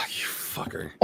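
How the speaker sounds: unvoiced sighing curse; mock outrage. Real, not acted. Betrayed by a friend's pick, whispered curse. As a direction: breathe it out in disbelief. Friend picks his favourite artist.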